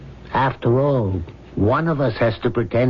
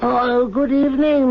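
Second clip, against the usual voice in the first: flustered surprise; 12 semitones higher than usual. Acted. Servant caught off guard.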